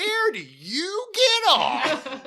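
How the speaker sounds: very high, drawn-out mock-heroic yell; wildly overacted. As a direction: shriek it slowly like an overacting cartoon hero. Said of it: "he really overacts this one".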